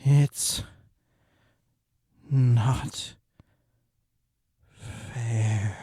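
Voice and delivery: slow, dying whisper of a voice; fading out. Acted. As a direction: let it fade to nothing. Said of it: "growing slower by the second".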